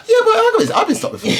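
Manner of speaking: very high, loud squeal easing off into laughter; mock protest. Woman acting out a "yeah but" reply to someone, mock protest. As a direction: mimic a squeaky, over-the-top objection.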